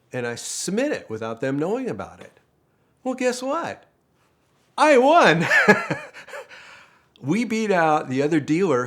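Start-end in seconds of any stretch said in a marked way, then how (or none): 3.0-5.4 high and loud, bursting with pride; spring the good news on them; thrilled reveal. Real, not acted. Telling the audience he won, thrilled and bursting. Triumphant punchline of the story. 5.5-6.7 breathy burst of happy laughter; delighted laugh. Real, not acted. Laughing at his own win, delighted. Laugh right after winning.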